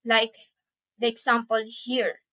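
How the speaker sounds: usual speaking voice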